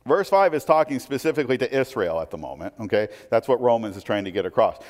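normal talking voice

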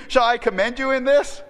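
usual speaking voice

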